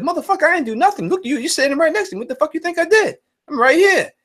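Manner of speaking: high, fast mock outrage; hammed-up angry denial; retold indignant protest. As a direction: protest your innocence, exasperated and fast.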